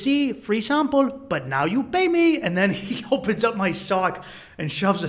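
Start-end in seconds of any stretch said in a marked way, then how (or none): 0.0-2.4 high, slow, drawn-out tout impression; Colombian street hustler. Hustler explaining payment to tourist, high imitated voice. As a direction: spell out the deal as the imitated hustler. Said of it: "And he goes".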